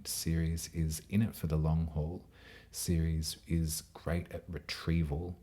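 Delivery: ordinary talk, nothing marked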